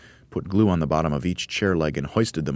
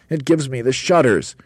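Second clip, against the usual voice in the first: higher, louder shuddering complaint; Roly-Poly Bird protesting; 8 semitones higher, 6 dB louder than usual. A narrator's reading. Bird dreading bird pie, protesting.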